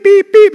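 comic censor beep over cursing; mock bleeps. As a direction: bleep out the swearing playfully.

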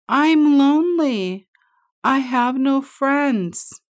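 high loud slow call; hollered. A narrator's reading. Lonely beaver hollering to the far shore. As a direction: cry out your loneliness across the pond. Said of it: "He hollered again".

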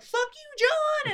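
hammed-up crew abandoning John; mock fed-up crew. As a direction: give up on John with a squeak. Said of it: "the helicopter's like".

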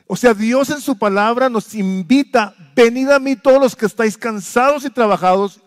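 loud, high, urgent sermon delivery; preaching at full force. Acted. Pastor pressing the congregation with urgent questions, preaching hard. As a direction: drive each question home to the crowd.

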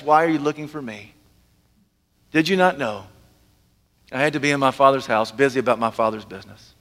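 low, unruffled reply; Jesus, very calm. Acted. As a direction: answer calmly, pitched well down. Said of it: "very calm, calmly says".

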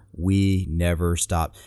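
We never stopped with each word hit, slow; slow, nod on every word; every word stressed. Shown as a demonstration. Demonstrating her word-by-word emphasis on We never stopped. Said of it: "her head actually nods with every word".

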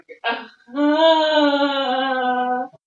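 loud, high emotional laugh; laughing through tears; tearful laugh. Real, not acted. Overwhelmed after the experience.

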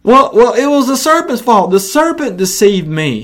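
high, pleading Eve blaming the serpent; whiny excuse. Acted. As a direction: squeak out an excuse to God.

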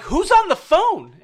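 loud, high-pitched demanding yell, re-enacted; frantic mock shout; retold shout. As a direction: act out yelling a question over chaos. Acted yell amid retold family chaos.

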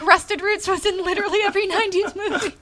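high, laughing self-mockery; amused and incredulous, laughing through the words. Real, not acted. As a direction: let the pitch climb as laughter breaks in. Doubting own memory, breaks into laughing.

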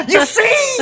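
high, loud, laughing shriek; hammed-up deranged doctor; manic shriek. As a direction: shriek it gleefully unhinged. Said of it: "He's like".

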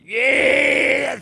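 high, loud mocking yeah; gleeful con man. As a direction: cheer it like a smug fraud. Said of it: "this guy's like".

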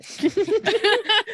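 giggling fit, high pitched; high burst of laughter. Real, not acted. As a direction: break into a helpless high laugh. Laughing at own lisp.

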